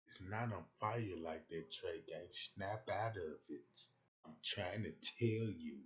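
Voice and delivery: a hint of a gentle woman; soft female voice. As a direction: patiently explain like she's coaxing him. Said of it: "Till a female told me".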